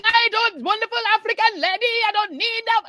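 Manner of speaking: high wailing imitation of a woman in labor; imitated labor roommate. Mimicking the African lady in the shared room, high wail. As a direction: wail it like a chant, high-pitched. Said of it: "this lady is saying".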